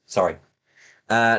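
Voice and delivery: normal talking voice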